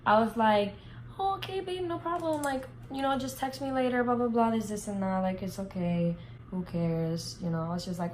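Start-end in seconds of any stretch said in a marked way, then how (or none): 1.2-7.4 put-on naive sweet girlfriend. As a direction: play the trusting girlfriend who suspects nothing. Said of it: "Me being the dumb, nice bitch I am".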